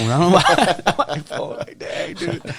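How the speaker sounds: high, laughing through the words; words breaking up into laughter. Real, not acted. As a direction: crack up while talking. Laughs while telling of wiping his phone.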